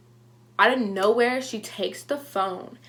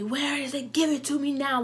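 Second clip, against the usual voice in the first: higher, mock screamed demand; mom screaming; 5 semitones higher than usual. Mom in her face demanding it back, a higher mock yell. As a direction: imitate mom yelling in her face, lightly. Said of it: "screaming at me".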